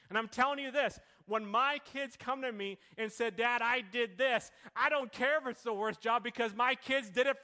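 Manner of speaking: loud, rising preacher's cadence; fired-up sermon delivery; preaching at full force. Acted. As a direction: preach it out to the congregation.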